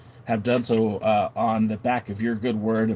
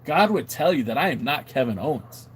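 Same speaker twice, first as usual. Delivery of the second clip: ordinary talk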